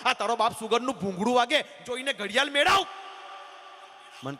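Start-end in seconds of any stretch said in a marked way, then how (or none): 0.1-2.9 raised, higher scolding shout at the caller; mock angry yell. Snapping at a prank caller, mock angry yell. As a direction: bark it at the pest who keeps phoning.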